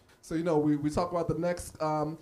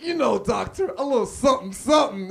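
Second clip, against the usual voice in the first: a bit louder, slangy swagger; hammed-up cool; 3 semitones higher, 5 dB louder than usual. Comic hamming it up to his doctor, mock swagger. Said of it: "I blacked it up".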